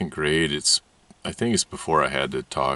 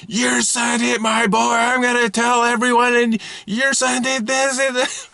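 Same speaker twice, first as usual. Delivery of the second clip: ranting and raving; 4 dB louder than usual. Retold or imitated. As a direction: rant at the neighbour like an outraged mother. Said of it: "starts ranting and raving".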